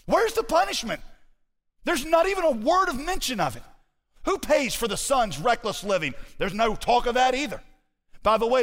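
very high, incredulous cry settling into forceful proclamation; impassioned, high-pitched preaching; full-force preaching. Acted. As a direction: fling out the questions from the pulpit, then drive home the answers.

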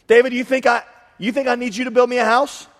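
God scoffing at David's offer; voicing God, incredulous. Preacher voicing God to David, amused incredulity.

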